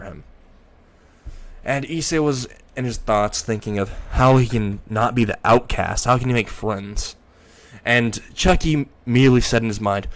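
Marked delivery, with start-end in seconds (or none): none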